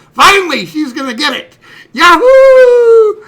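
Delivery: high, shouted mock celebration; jubilant outburst. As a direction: shout it out, ending in a soaring whoop. Boy celebrates sister's coming punishment.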